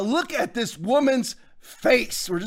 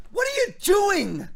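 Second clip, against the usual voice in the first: incredulous shout; 10 semitones higher than usual. Acted. Mock outrage at Trump.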